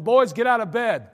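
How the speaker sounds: loud stern order to get up; stern wake-up call. Retold or imitated. Father waking his sons at night, loud stern order. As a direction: bark the boys out of bed.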